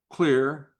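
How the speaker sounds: ordinary talk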